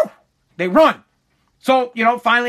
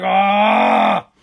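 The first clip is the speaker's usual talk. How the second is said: long, loud mock "ahh"; drawn-out imitated cry of dismay; retold alarmed groan; 4 dB louder than usual. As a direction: groan out loud at being given away. Reacting to the loud door.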